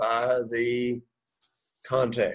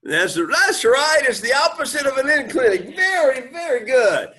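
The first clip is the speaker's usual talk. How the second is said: excited praise; 10 semitones higher, 3 dB louder than usual. Real, not acted. Teacher praising a student's right answer, excited. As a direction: cheer on a correct answer, voice way up.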